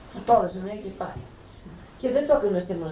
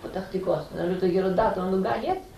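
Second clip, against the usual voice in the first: ordinary delivery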